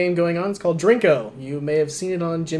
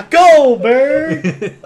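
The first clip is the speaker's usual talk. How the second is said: mock cheer; 10 semitones higher, 10 dB louder than usual. Mock cheer for Mighty Ducks.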